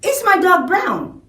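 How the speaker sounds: high questioning voice, falling at the end; asking tone. Shown as a demonstration. Teacher demonstrating a question to pupils, high asking tone. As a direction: raise the voice to ask. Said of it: "what if I ask".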